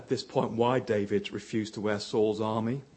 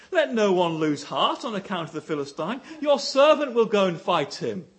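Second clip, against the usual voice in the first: bold confident declaration; 6 semitones higher, 7 dB louder than usual. Retold or imitated. David boldly addresses king.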